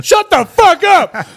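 high, drawn-out shout; yelling homeless guy. Acted. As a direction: holler it from out on the street. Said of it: "like, a homeless guy outside going".

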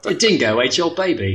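hammed-up Australian movie line; mock Australian accent. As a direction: quote it in broad Aussie. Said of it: "let's get some Aussie lingo".

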